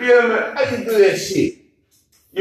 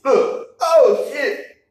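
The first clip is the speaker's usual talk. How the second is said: excited retelling; 6 semitones higher than usual. Retold or imitated. Retelling a shocking surprise.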